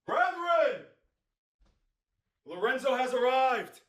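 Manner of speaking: booming, high proclamation; grand entrance. Pretend. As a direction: announce yourself like a god.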